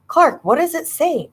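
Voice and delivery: high, sharp demand. A narrator's reading. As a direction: insist he tell you now. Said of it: "I demanded".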